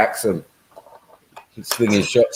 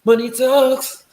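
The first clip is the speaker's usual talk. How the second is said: hammed-up high voice; slower than usual. Hammed-up repeat with laughter.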